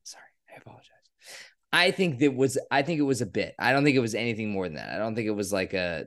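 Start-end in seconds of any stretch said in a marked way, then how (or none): 0.0-0.9 breathy whispered apologies, barely there; sheepish whisper after swearing too much; whispered sorry. Real, not acted. Apologizing under his breath to his co-host after cursing. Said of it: "He's drunk".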